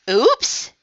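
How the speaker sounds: high, loud, startled yelp; crying out. A narrator's reading. Froggy almost falling in, startled cry. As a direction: yelp it as if slipping. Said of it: "cried Froggy".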